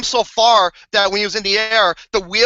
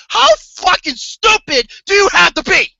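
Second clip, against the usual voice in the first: exasperated shout; 8 semitones higher, 4 dB louder than usual. Retold or imitated. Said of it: "I'm like".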